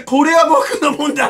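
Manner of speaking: mimicking Frodo clutching the ring; Frodo's line. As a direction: say it like it's your precious ring. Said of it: "フロド・バギンスみたいにさ". (フロドのまねで軽く)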